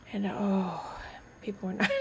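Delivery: hushed whisper. Real, not acted. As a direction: whisper it like gossip. Complaining about cranky people.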